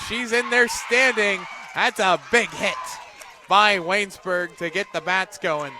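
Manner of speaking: loud, high, rising play-by-play shout over a big hit; roaring broadcaster call of a clutch base hit; excited call. Real, not acted. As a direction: let it rip as the ball finds the outfield. Big base hit called live.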